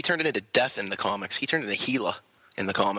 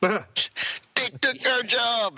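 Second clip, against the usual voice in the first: hammed-up they took our jobs, very high and slow; South Park yokel yell; 12 semitones higher, much slower than usual. South Park catchphrase quote.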